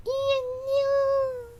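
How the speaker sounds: very high, whining little cat voice; lightly catty high whine. Retold or imitated. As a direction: mew it out like a cat. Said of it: "when I said".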